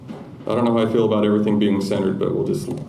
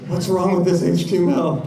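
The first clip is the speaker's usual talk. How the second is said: higher, louder baffled coworker asking; lightly voiced perplexed colleague; puzzled coworker; 8 semitones higher, 4 dB louder than usual. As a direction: imitate a confused reviewer squinting at code. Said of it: "my coworker's like".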